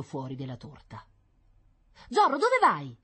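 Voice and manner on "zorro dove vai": high, loud call after a runaway dog; a boy yelling after his dog. Acted. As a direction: shout after Zorro as he runs off. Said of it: "gli gridò dietro Paolo".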